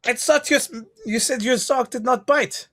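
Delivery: high, indignant mock French accent; Peter Sellers French accent. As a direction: complain in a Clouseau French accent. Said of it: "by doing that accent".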